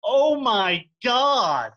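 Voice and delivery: drawn-out, loud, slow "Oh my God"; mock Thor. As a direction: stretch it out and belt it like a shocked hero. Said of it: "Thor turns and goes".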